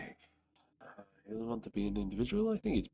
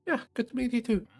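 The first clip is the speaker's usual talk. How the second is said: tiny ant voice; 14 semitones higher, 6 dB louder, faster than usual. Acted. Ant character voice.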